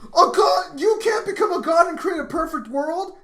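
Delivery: high, loud disbelieving shout; incredulous shout. Real, not acted. Shouting at his co-host in disbelief over a god. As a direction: yell it in disbelief, pitch way up.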